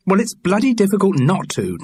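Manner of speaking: peeved, theatrical grumble; complaining. A narrator's reading. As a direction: complain with actorly indignation. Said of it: "Charles Paris complained".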